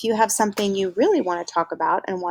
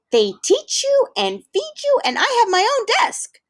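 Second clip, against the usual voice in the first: high, bright rush of excitement; a boy thrilled about his new school; eager high child voice; excited kid voice; 4 dB louder than usual. Acted.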